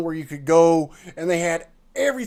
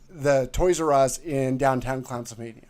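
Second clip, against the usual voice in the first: normal talking voice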